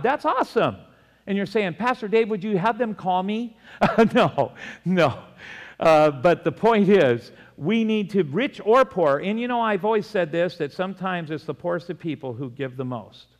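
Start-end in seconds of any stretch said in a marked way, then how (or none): 0.0-10.6 loud, high, fervent preaching; raised pulpit voice; preaching at full force. Acted. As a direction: drive it home to the congregation. Preaching loud about riches.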